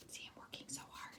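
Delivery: breathy whispered aside. Acted. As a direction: whisper it like a secret. Aside as working Hare.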